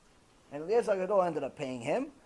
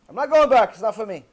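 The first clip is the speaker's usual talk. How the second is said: upset; 3 semitones higher, 10 dB louder than usual. Retold or imitated. Upset student tells wife he's quitting, loud protest. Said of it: "He got so upset".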